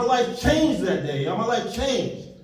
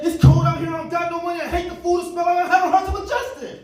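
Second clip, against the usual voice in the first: high, whiny, a little louder, listing complaints; whiny teen excuses; 7 semitones higher, 4 dB louder than usual. Retold or imitated. Marquel listing his excuses to the speaker, high and whiny. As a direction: whine out every excuse like a sulky teen. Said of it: "You know what Marquel said?".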